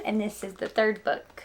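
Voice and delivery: ordinary delivery, nothing marked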